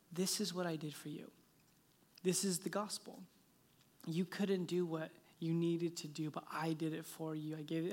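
soft, low, gentle address; Holy Spirit. Retold or imitated. Voice speaking to a tearful father, soft and low. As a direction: speak quietly and tenderly, with pauses. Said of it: "I hear the Holy Spirit just say to me".

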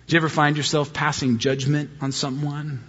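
ordinary talk

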